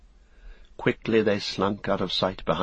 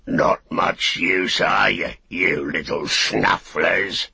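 slow, louder, mocking brute orc; big orc; 4 dB louder, slower than usual. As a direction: sneer it like a big fighting orc. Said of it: "said the big orc".

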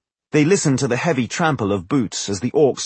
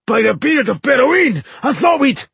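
high, loud enraged roar; furious high-pitched dwarf yell; howl of rage; 14 semitones higher, 6 dB louder than usual. A narrator's reading. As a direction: bellow it in outraged disbelief. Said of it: "a terrible howl of rage".

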